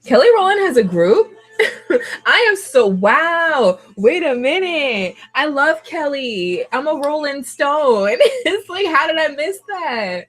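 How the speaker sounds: high, stretched-out disbelief; real amazed, drawn-out exclaiming; astonished. Real, not acted. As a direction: react to news you can't believe you missed. Startled by news of singer's group.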